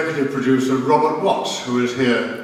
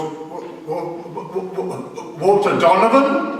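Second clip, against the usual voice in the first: mock dumbstruck stuttering, pitched up; stunned stammer; 5 semitones higher, slower than usual. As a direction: stammer in disbelief, then blurt the name. Acts out stunned reaction to news.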